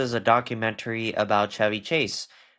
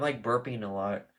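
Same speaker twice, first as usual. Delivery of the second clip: ordinary talk, nothing marked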